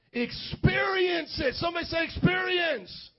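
high, slow, drawn-out preacher's shout; rousing preacher's call. Real, not acted. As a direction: call it out for the congregation to repeat. Preacher rallies crowd for response.

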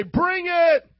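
high loud shout; loud call-out. Real, not acted. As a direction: shout it back. Call-out response.

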